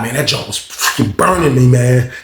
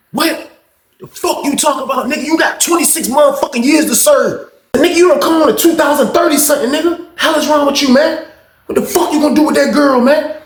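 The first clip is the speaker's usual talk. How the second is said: angry yell; 11 semitones higher than usual. Retold or imitated. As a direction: tear into him high and furious. Keon yelling in the fight.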